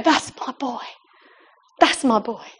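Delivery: softly voiced, tender pride. Jesus proud of the man preaching, quiet and tender.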